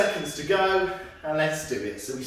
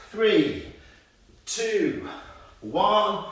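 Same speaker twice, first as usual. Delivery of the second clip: louder, higher coach count; coach countdown; 4 semitones higher, 5 dB louder, much slower than usual. Real, not acted. Instructor calling the last seconds to the class, louder and higher.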